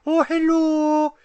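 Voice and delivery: very high, loud, slow squeaky greeting; Nerdy Jesus impression. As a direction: join in mocking a friend's high voice. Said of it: "he talks like this".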